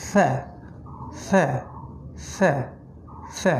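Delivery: ফ্যা said lightly, tongue at the teeth; repeated letter drill. Shown as a demonstration. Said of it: "এখান থেকে".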